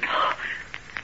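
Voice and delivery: sharp intake of breath in alarm; sudden horrified gasp; frightened gasp. Acted. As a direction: gasp at being found out. Ivy realises she was overheard.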